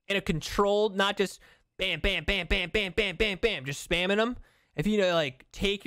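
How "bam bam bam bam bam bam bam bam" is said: rapid, evenly repeated "bam" gunfire; imitated gunshots. Streamer warns viewers against spamming shots, imitated rapid gunfire. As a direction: rattle off shots like spammed fire.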